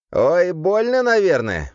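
high, loud exclamation of mock pain; mock sympathy. A narrator's reading. Demon Legion remarking on a guard thrown at the wall, mock sympathy.

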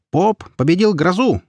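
high, mock-triumphant cry; teasing, high-pitched proclamation; joking exclamation. A narrator's reading. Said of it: "шутливо воскликнул гавайец".

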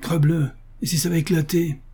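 understated shocked outcry; startled exclamation. A narrator's reading. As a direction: exclaim in disbelief without raising the volume. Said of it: "le colonel qui s'exclama".

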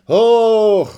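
high, loud excited cheer; excited shout. A narrator's reading. Girls waving at the soldiers, cheering in a high loud shout. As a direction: yell it out with joy. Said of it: "begeistert ... schreien".

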